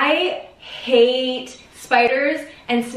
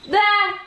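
sharp high shriek of fright; screaming at a spider; 9 semitones higher than usual. Real, not acted. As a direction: yelp at the creepy bug. Spider startles her.

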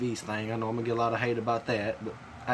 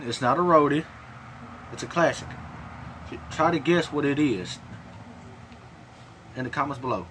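speaking around tobacco pouch. Real, not acted. Seen 3: Lower lip looks full throughout; spits into bottle at 10.5 s.

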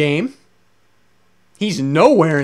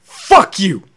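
real explosive outburst, louder; furious shout; 8 dB louder than usual. Real, not acted. Raging gamer yells at the game studio, furious shout. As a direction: yell it straight at the developers, fully enraged.

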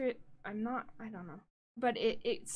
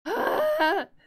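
loud burst of laughter; 9 semitones higher, 10 dB louder than usual. Real, not acted. Laughs at the broken stream.

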